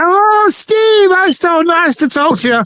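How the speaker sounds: high-pitched mock granny voice; delighted old gran. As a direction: coo like a gran thrilled by a call. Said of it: "if an old person".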